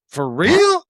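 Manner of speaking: high, loud squeak of shock; shocked disbelief. Retold or imitated. Hearing the insurance cost, a high loud shocked squeak. Hearing forty thousand a year.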